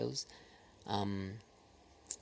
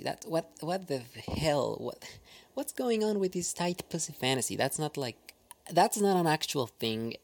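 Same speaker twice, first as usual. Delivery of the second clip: incredulous; 6 semitones higher than usual. Real, not acted. Incredulous rant about script trope.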